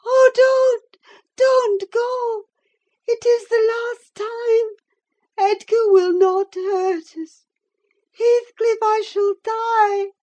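very high, loud, wailing plea; desperate shrieking. A narrator's reading. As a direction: beg him frantically, stretching out the cries. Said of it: "she shrieked".

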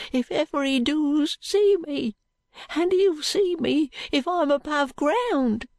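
put-on slow, high, fearful street boy; halting, small high voice, confiding nervously. A narrator's reading. Jo telling Allan how he was sent away, high fearful voice. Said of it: "replied Jo, whispering".

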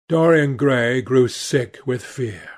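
ordinary delivery

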